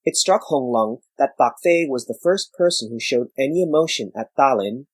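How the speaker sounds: plain reading voice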